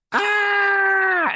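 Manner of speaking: high, loud, held mock scream. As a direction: imitate her long shrieked "Ah!". Imitates her scream as she falls.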